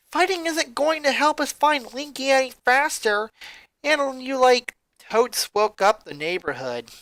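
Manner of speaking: scolding character voice. Acted. Eldest sister scolds the others, mildly annoyed. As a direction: tell them off, a bit annoyed.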